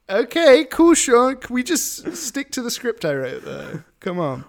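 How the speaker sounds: host begging his co-host to stick to the script; flustered laughing plea. Real, not acted. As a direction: rein in your partner while trying not to laugh. Host pleads as co-host goes off-script.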